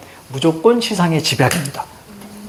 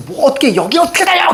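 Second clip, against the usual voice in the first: mock indignant retort; 8 semitones higher than usual. Retold quarrel comeback.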